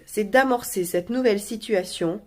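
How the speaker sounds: ordinary delivery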